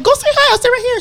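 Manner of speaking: retelling how she nudged her cousin, high voice; high urging quote. Retold or imitated. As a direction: push him to go say hi, high and bright.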